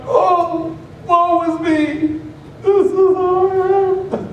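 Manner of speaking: high, slow hammed-up woe; mock lament. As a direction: wail it like a melodramatic victim. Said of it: "We're crying to God".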